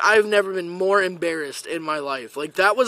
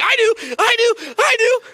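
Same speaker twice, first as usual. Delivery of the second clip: high, insistent student begging to be picked; eager volunteering; 5 dB louder than usual. Retold or imitated. Student volunteering for the teacher, high and eager. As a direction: burst out high, imitating an over-eager volunteer.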